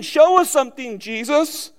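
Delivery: mock-demanding crowd voice. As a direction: mimic the crowd demanding a sign. Preacher voicing demanding crowd.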